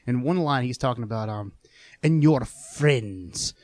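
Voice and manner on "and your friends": put-on very Scottish accent, drawn out; Palpatine. Fan showing friends the Emperor's accent, slow and Scottish. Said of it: "it's very Scottish".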